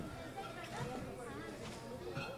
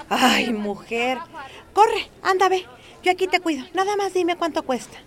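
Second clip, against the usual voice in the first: much higher and louder, pushing her to go; urgent urging; 9 semitones higher, 10 dB louder than usual. Retold or imitated. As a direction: shoo her off eagerly, then rush through the rest. Marta urges her to go.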